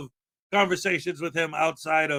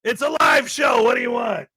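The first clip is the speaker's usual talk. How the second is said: louder, higher playful yell; host yelling back at a live chat joke, loud and playful; playful shout; 4 semitones higher, 5 dB louder than usual. Real, not acted. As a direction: bark back at the heckler in fun.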